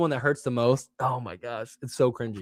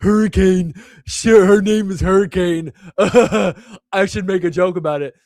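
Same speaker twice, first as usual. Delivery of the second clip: higher, louder goofy inner voice, laughing through it; dumb inner voice; 4 semitones higher, 6 dB louder than usual. Retold or imitated. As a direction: ham up your own dumb brain cracking a joke. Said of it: "my dumb brain was like".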